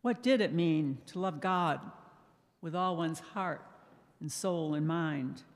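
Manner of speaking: ordinary talk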